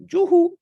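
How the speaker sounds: playful whoop. Real, not acted. Whoop after a pun.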